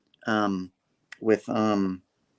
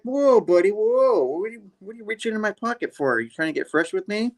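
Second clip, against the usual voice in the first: high, fast mock protest; high startled Spidey; 9 semitones higher than usual. Spider-Man catching the goose in his pocket, high mock protest. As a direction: squeak it out, playfully affronted.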